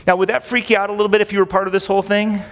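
ordinary delivery

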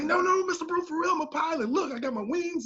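high-pitched, earnest mock protest; protesting student. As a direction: imitate a young man pleading to be believed. Imitates student protesting his innocence.

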